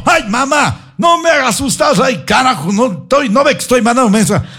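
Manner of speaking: high mock complaint, shouted; a hammed-up irritated daughter snapping back; annoyed daughter. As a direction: Manuela snaps at her mother, high and annoyed.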